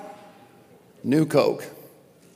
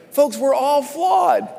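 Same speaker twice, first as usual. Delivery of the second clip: shouted declaration; 6 semitones higher, slower than usual. Real, not acted. Preacher shouting to congregation.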